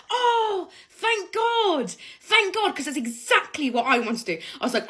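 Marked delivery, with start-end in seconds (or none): 0.1-2.7 high-pitched, soft gush of relief; hammed-up relieved exclamation; huge relief. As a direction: act out sighing with grateful relief. Retold relief at shared annoyance.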